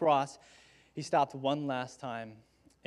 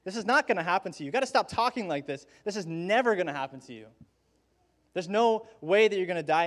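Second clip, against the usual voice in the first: higher-pitched, insistent plea, building louder; protesting Peter; 4 semitones higher, 4 dB louder than usual. Acted. Peter pulling Jesus aside, protesting urgently. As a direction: refuse to accept it, urgently objecting. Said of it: "Peter pulling Jesus aside and saying".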